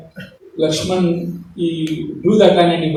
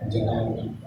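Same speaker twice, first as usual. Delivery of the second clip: usual speaking voice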